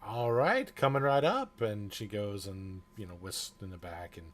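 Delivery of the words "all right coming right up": cheery barmaid. Acted. Barmaid answering order.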